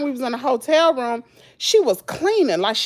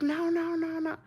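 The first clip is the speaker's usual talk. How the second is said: much softer, fading almost to nothing; hushed pleading; 3 semitones higher, 12 dB quieter than usual. Retold or imitated. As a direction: plead quietly. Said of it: "She was like".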